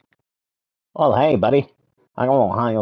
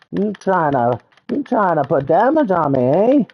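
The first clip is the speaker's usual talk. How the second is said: high creature voice taunting the attacker; high mocking critter; 7 semitones higher than usual. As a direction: needle him in a squeaky cocky voice.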